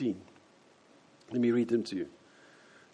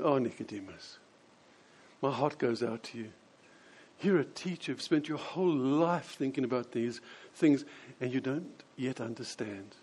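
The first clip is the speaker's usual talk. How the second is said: soft, lowered, compassionate voice; tender sympathy; 3 semitones lower, 5 dB quieter than usual. Acted. Preacher voicing Jesus with a hand on the old man's knee, tenderly. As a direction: console him gently, trailing softer. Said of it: "leaning across and just laying a hand".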